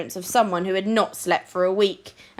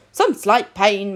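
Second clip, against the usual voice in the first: ordinary delivery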